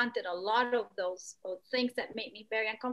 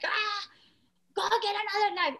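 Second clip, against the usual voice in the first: high-pitched mock shout, faster; mock yell; 9 semitones higher than usual. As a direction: imitate someone yelling, hammed-up and quick. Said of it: "you scream at somebody going like".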